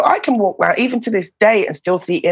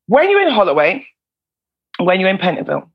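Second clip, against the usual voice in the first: ordinary delivery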